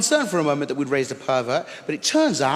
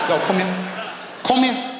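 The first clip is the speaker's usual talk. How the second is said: bossy calling; 3 semitones higher than usual. Pretend. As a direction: call the nephew over. Bossing nephew.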